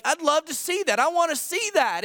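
high-pitched mock eagerness; eager. Preacher voicing eager readers of Acts, excitedly wishing. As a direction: pitch it up like a keen onlooker.

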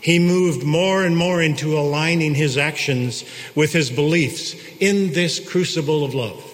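ordinary talk

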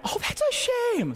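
sing-song fake sorrow; mock pity. Pastor teasing the congregation with falsetto mock pity.

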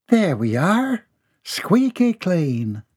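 pleased little motherly coo. A narrator's reading. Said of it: "mother cleans son's face".

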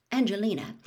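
plain reading voice

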